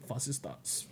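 very soft mock-German line; imitated German accent. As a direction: quote it in a hushed German accent. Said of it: "listening to your German accent".

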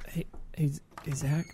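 hushed whisper to wake someone. Retold or imitated. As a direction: whisper his name quietly through the door. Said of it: "I was like".

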